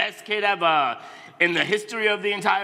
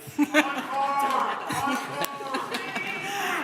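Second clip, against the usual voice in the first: crowd laughter; 8 semitones higher, 3 dB quieter than usual. Real, not acted.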